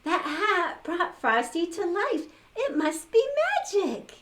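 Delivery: high breathless amazement; awed girl. A narrator's reading. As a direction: marvel at the magic hat. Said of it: "gasped and said".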